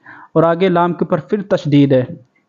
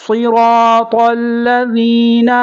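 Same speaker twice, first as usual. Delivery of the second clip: higher, melodic tajweed chant; chanted tilawat, higher; Quran recitation; 4 semitones higher than usual. Shown as a demonstration. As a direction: recite it sung for students to copy. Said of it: "اب میرے ساتھ دہرائیں گے،".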